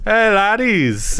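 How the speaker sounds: put-on slow, drawn-out Irish accent; a stagey Irish accent. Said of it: "Do your Irish accent".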